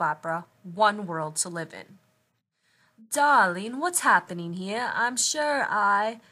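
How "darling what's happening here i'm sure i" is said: put-on loud, theatrical English-accented lady; fake English accent. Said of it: "in a fake English accent".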